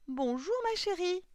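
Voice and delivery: put-on high, loud old man's greeting; Coppelius. A narrator's reading. Old clockmaker greets his doll, high loud doting voice. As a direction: greet your darling in a high, ringing voice. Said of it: "dit Coppelius".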